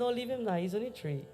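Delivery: soft, dismissive excusing; indulgent parent. Acted. Parent excuses a three-year-old who hit, soft indulgence. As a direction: excuse the child very softly.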